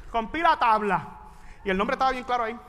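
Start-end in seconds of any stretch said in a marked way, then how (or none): none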